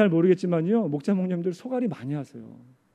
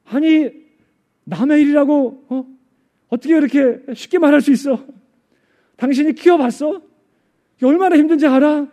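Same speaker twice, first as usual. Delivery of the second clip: loud, high indignant retort; angry outburst; 10 semitones higher, 12 dB louder than usual. Retold or imitated. Pastor inwardly raging at the elder's easy words, angry and loud. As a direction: imitate a fed-up father blowing up, voice well raised. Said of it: "이러면서 막 화가 나더래요".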